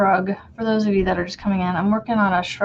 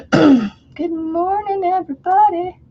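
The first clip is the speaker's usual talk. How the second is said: high, slow, drawn-out greeting; sing-song greeting; 9 semitones higher, 5 dB louder, slower than usual. Real, not acted. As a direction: stretch it out high and sweet. Greeting viewers in high stretched voice.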